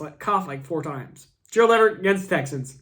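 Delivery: usual speaking voice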